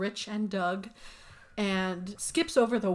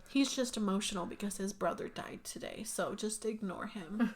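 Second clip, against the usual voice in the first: hushed aside; 8 dB quieter than usual. Retold or imitated. Barb's aside retold.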